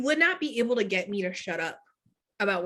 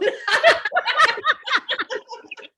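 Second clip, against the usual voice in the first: loud high laugh; 14 semitones higher, 5 dB louder than usual. Real, not acted. Laughing at own joke.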